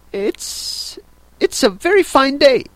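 high, hesitant small talk; nervous little high voice; timid voice. A narrator's reading. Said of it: "said a timid voice".

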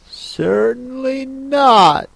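high, drawn-out indignant refusal. A narrator's reading. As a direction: stretch it out high, offended. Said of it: "said Alice indignantly".

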